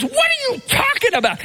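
high, incredulous cry; excited disbelief. Retold or imitated. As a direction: squeal it out, amazed. Soloist cries out in disbelief.

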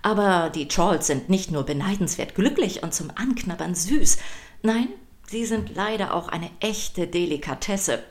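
plain reading voice

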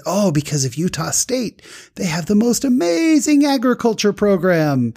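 high, gushing enthusiasm; Abdulia. Retold or imitated. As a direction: rave about it, pitch climbing high. Driver enthusing, acted out.

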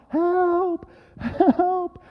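high, drawn-out calls for help; yelling out. Retold or imitated. Lost hikers keep walking and calling out, high yell. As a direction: holler for rescue in a high voice. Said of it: "yelling out".

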